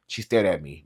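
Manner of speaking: ordinary delivery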